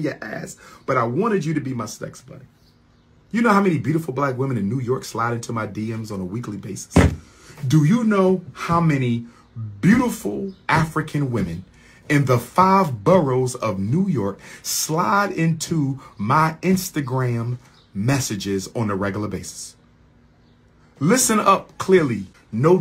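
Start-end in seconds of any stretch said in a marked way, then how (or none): 3.3-6.9 fast, run-together question; quick boast. Real, not acted. Man boasts about admirers in New York, rapid-fire. 7.7-19.7 measured question broken by pauses; emphatic restatement. Real, not acted. Man restates boast about New York admirers, deliberate and emphatic.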